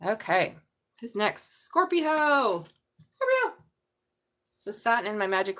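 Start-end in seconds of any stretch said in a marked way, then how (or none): 1.6-2.7 calling out. Real, not acted. Calling out to next sign. 3.1-3.6 high excited call. Real, not acted. Calling out, higher again.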